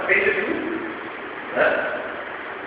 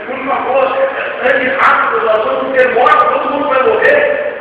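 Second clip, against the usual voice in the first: raised, higher, louder pressing; urgent frightened plea; 9 semitones higher, 6 dB louder than usual. Retold or imitated. People in the boat pressure the mother, frightened urging. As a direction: push her to act before they die. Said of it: "todo mundo falando pra ele".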